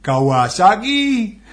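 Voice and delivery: high, loud, drawn-out Geordie "Kawasaki"; Geordie accent. Retold or imitated. Retelling a comic's lesson on learning Geordie, Geordie accent. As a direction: belt it out slowly in mock Geordie. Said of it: "he was saying, to learn Geordie".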